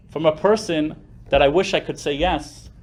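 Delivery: usual speaking voice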